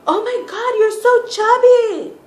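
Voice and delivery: shrill high, loud mock exclamation; loud high-pitched mock shock; mocking the relative. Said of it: "this person says".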